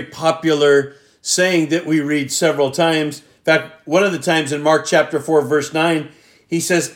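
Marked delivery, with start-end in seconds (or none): none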